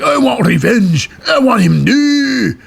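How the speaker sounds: high, slow mock Scottish cry; hammed-up Scottish accent, vowels stretched high; Sheamus, Scottish accent. Sheamus demands revenge for his friend, high exaggerated Scottish accent. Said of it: "why he's got a Scottish accent".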